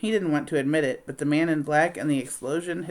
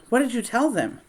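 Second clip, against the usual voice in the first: high, alarmed question; gasped alarm; 8 semitones higher than usual. Acted. Amy gasping at Nellie, high alarmed question. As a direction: blurt it out in shock.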